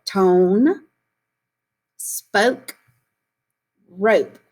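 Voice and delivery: Tone, spoke, rope each drawn out clearly; model words for echo. Shown as a demonstration. Teacher models Tone, spoke, rope for kids to echo, drawn out. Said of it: "Echo this word as I say it".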